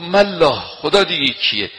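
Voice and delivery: the enraged swordsman mocking the Prophet's answer; retold anger. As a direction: spit the scorn back angrily. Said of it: "این بیشتر عصبانی شد".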